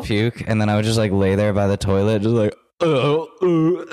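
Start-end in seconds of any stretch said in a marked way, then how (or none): 2.8-3.8 high imitated retching groan; sick groan. Hungover woman lying by the toilet, groaning.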